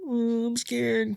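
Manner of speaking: high, soft, drawn-out little whimper; mock scared. Kid facing the cops, hammed-up small scared voice. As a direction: imitate a frightened kid, small and slow. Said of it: "And I was like".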